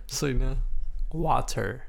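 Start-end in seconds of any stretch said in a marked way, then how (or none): 1.1-1.8 "water" pronounced carefully with a clear T. Shown as a demonstration. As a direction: sound out "water" on request, t and all. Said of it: "try to pronounce it".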